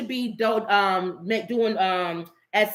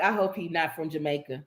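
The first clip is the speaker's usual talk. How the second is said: hushed mock worry; 5 semitones lower, 6 dB quieter than usual. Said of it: "the West Indians was like".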